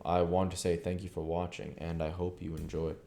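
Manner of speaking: usual speaking voice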